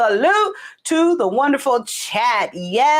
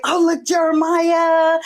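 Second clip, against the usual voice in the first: mildly gushing, raised voice; higher, excited retelling; retold excitement; 5 semitones higher than usual. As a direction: act out your own excitement. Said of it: "I was, like, all excited".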